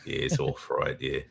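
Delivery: imitation Australian accent; Aussie accent. American host shows co-host the onion, put-on Aussie accent. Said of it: "You do a pretty good Aussie accent".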